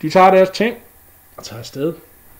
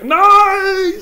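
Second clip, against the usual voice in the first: anguished cry; 13 dB louder than usual. Acted. Sindre fails, cries out.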